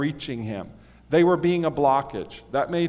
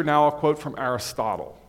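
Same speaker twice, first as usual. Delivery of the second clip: normal talking voice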